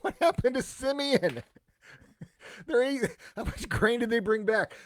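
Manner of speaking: can't believe they forgot him; amused disbelief. Real, not acted. Teacher laughing to the class at forgotten Simeon, amused disbelief.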